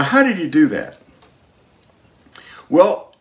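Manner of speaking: ordinary talk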